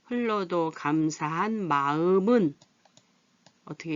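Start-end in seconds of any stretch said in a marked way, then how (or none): 0.0-2.5 마음은 said slowly as "마으믄"; slow teaching read of 마음은 as "마으믄"; linked reading. Shown as a demonstration. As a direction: read slowly, carrying ㅁ over: "마-으-믄". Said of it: "이렇게 읽어요".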